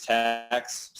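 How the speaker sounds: ordinary talk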